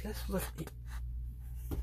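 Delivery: slow, breathy whisper. Real, not acted. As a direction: whisper it as if telling a secret. Hushed while opening the book.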